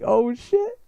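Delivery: high, squeaky double "oh shit"; jumping up in alarm on the second one; stunned "oh shit". Retold or imitated. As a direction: gasp it out, shocked. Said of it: "And I'm just like".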